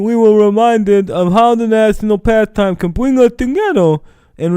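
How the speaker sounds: high mocking official read-out; mock Rob Manfred voice. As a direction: parody a stuffy commissioner being sincere. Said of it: "the actual voice of Rob Manfred".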